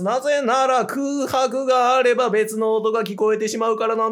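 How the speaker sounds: 高めの張った声で休まず一気にまくしたてる; 落語家気取りで間を空けずに畳みかける; all in one breath. Shown as a demonstration. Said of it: "今回はもう息継ぎなしで". (息継ぎなしで一気に語る)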